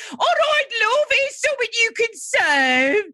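high, hammed-up Brummie greeting; Brummie accent. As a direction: read a Valentine's card in exaggerated high-pitched Brummie. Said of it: "You can get your Brummy accent".

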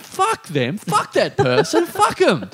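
high-pitched retold angry outburst; climbing into high, drawn-out mock fury; really angry. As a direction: imitate a friend cursing someone out. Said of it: "getting, like, really angry about this other person".

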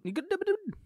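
high, hammed-up Scottish accent on double done; teasing a co-host with an over-the-top Scottish accent. Podcaster mocking his co-host's line in a high hammed-up Scottish accent. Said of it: "In your best Scottish accent".

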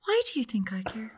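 breathy hushed jab; whispered comeback to a man called Mick; whispered retort. Retold or imitated. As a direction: hiss it under your breath.

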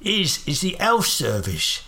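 slow Cockney voice dropping the h in health, halting; chagrined Cockney. Acted. Holmes confused by the guard, chagrined Cockney. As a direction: stammer like a put-out Cockney. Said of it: "clearly chagrined".

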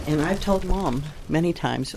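ordinary talk, nothing marked

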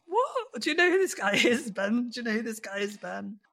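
very high-pitched, hammed-up disbelief; mock incredulity. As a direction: act out a whole nation's baffled astonishment. Mimics baffled British viewers.

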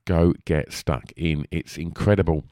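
ordinary talk, nothing marked

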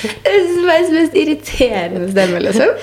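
slow, laugh-filled complaint. Real, not acted. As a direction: say it while laughing helplessly. Laughing at own voice.

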